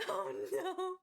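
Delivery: high laughing groan; half laugh, half cry of dismay; laughing despair. Real, not acted. Cashier laughing at her own guilt, half crying. Retelling breaking kid's heart.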